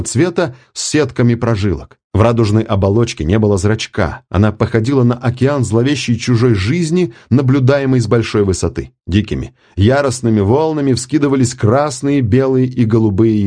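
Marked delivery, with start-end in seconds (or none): none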